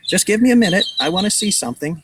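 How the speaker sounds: high, loud and eager; excited. A narrator's reading. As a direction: blurt it out, keen to look. Mitch refusing to leave.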